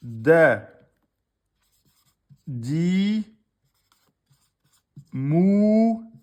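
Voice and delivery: loud spelled-out syllables, each on its own; sounding out a word slowly for students. Shown as a demonstration. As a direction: call out each syllable for the class. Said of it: "Le deuxième mot".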